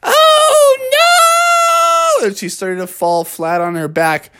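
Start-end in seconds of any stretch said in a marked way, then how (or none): 0.0-2.3 very high, long wail; acted dread as she topples; drawn-out falsetto cry; alarmed granny. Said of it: "Grandma Bear said".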